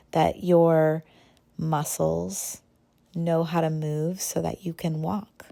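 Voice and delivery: ordinary delivery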